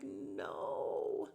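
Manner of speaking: long, whispery low "no"; hushed retold heartbreak; dismayed. Retells dismay at tearful scene.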